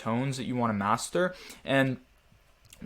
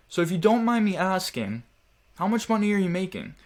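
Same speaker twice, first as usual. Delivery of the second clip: higher, curious and non-threatening; inquisitive tone; 7 semitones higher, 3 dB louder than usual. Shown as a demonstration. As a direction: ask it lightly, pitched up, nothing pushy. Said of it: "that was with a different tone that was non-threatening".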